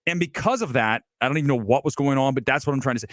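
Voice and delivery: ordinary talk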